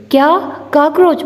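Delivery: a sudden high alarmed squeak; a mock-panicked what, cockroach; alarmed surprise. A narrator's reading. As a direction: jump up in shock at the word. Hearing about the cockroach.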